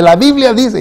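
grito agudo invocando la Biblia; vociferando. Retold or imitated. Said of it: "el hombre comenzó a vociferar". (esposo grita citando la Biblia a su mujer)

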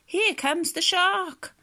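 high, drawn-out storybook alarm; terrified cry. Acted. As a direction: play frightened turtles, slow and high. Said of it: "The turtles were terrified".

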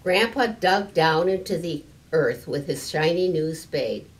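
usual speaking voice

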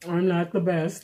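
usual speaking voice

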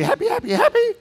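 climbing squeaky happy voice. Shown as a demonstration. Preacher acting out a happy face, squeaky and rising. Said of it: "goes from smiley".

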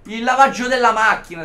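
loud, high, indignant outburst; fed-up gamer venting at the detour; outraged rant. Real, not acted. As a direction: complain to the screen at full voice. Ranting about the long route.

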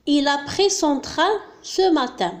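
slow, deliberate dictation pace; slow example. Shown as a demonstration. Teacher reads a French example sentence to students, slowly. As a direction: read it so learners can follow. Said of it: "مثال".

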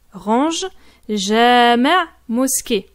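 normal talking voice

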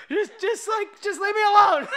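high, loud exasperated plea; laughing frustration; mock-annoyed leave me alone. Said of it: "getting annoyed".

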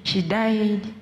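ordinary delivery

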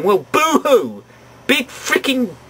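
drawn-out sarcastic boo-hoo; slowly, scornfully mock a weak excuse; dismissive mock pity; mock sympathy. Mocks the villain's excuse.

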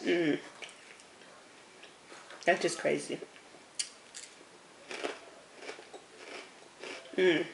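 talking while chewing snack; crunchy snack in mouth; mouth full, chewing. Real, not acted. Seen 0: Bites Cheeto at 3.8s; chews between bites while talking.

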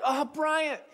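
high-pitched sympathetic "aww" tone; touched sympathy. Acted. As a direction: imitate a crowd melting with sympathy. Congregation moved by emotional speaker.

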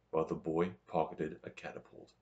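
ordinary delivery, nothing marked

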